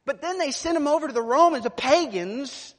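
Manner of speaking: loud, high pulpit cry; pounding sermon voice; full-force preaching. Acted. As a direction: drive it home to the congregation.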